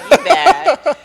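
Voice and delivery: high, loud teasing "you bad"; playful scold. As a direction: tease her for the cheeky joke. Teasing friend's cheeky remark.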